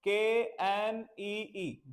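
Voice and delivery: very slow letter-by-letter spelling; teacher spelling K-N-E-E very slowly. Shown as a demonstration. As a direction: spell every letter deliberately. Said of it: "इसकी spelling बोलेंगे".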